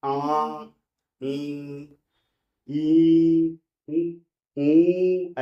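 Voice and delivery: आ, ई, उ, ऊ said through mouth and nose together; nasalised आ, ई, ऊ, spoken half through the nose; nasal vowels. Shown as a demonstration. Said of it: "साथ साथ में हम लोग नाक से भी बोलेंगे".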